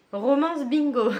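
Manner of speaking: reading it out through real laughter; can't hold back the giggle on the words; amused burst of laughter while reading the bookmark; laughing. Real, not acted. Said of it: "Pardon, je rigole".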